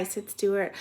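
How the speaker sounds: ordinary delivery, nothing marked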